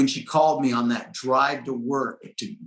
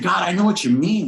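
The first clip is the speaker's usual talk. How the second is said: pleading prayer; 8 semitones higher, 3 dB louder than usual. Retold or imitated. Pleading with God in retelling.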